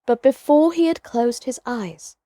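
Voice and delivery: ordinary delivery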